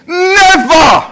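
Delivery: "Never" high and loud, falling hard; emphatic never. Shown as a demonstration. Pastor repeating the word for the church, emphatic. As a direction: punch "Never" like a promise. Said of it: "Say it like I'm saying it".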